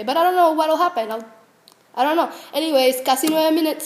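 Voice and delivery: usual speaking voice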